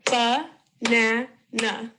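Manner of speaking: "Ba-na-na" broken into three drawn-out beats; sílaba por sílaba. Shown as a demonstration. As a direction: say "Ba-na-na" slowly, each syllable separate. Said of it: "broken up into syllable sounds".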